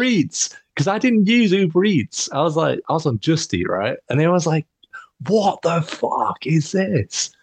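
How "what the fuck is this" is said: high, drawn-out mock outrage; stunned disbelief. Account holder finding unknown Uber Eats charges, slow incredulous outcry. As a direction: stretch every word in disbelief. Retold shock at strange transactions.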